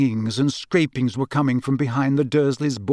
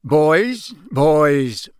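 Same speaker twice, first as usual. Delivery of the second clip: loud, drawn-out vague calming; 5 dB louder than usual. A narrator's reading. Father to bickering sons, vague. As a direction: absently shush the squabble. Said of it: "said Mister Weasley vaguely".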